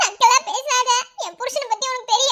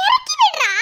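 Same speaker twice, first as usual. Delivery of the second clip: whiny cry; 9 semitones higher, 4 dB louder than usual. Real, not acted. Wife pleading to be put down.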